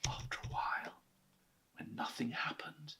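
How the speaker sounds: hushed unvoiced narration; storyteller whispering the hush. A narrator's reading. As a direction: whisper so as not to wake him.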